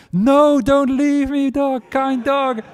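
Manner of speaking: very high, begging voice, slowing at the end; pleading postman. Acted. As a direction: beg the dog not to go. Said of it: "the postman was like".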